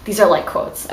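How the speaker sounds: ordinary talk, nothing marked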